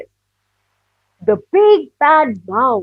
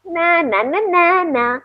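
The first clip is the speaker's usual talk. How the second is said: teasing taunt; 5 semitones higher than usual. Acted. Mouse taunts after tricking child.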